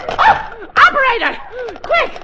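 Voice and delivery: high, loud panicked cries for help; flustered old lady calling the police in a panic; frantic alarm. Acted. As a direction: yell for the operator in a flap.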